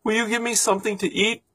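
put-on high, pleading child's voice; small hungry boy. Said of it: "said the boy".

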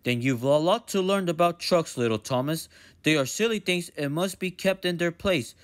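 steady, lecturing director voice; stern fat director. Acted. Director teaching Thomas about trucks, firm and lecturing. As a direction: lecture the little engine firmly.

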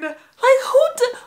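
high, loud, incredulous outburst; amused disbelief. Real, not acted. Marveling at the kid destined for TV, high excited disbelief. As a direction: squeal it, tickled and amazed. Tickled by her toddler self.

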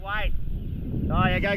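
ordinary delivery

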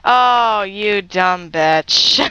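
mock pitying drawl; unimpressed onlooker. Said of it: "They just watched me fall, like".

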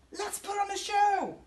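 high, loud cheerful shout; excited shout. A narrator's reading. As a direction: shout it out to the whole band. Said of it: "Yucky Monster shouts".

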